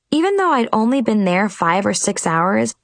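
plain reading voice

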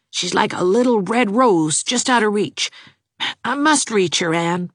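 lightly voiced eager young man; a hint of a lovesick young suitor, pleading. A narrator's reading. Jarvis's voice in the story.

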